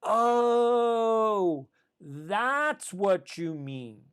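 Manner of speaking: long drawn-out "oh", then slow understanding; dawning realization. Acted. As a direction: act out a student's aha moment, stretching the words. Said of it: "that epiphany where the student goes".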